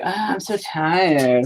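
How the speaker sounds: mocking a tired kid. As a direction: drag it out like a sleepy kid. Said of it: "And then she's like".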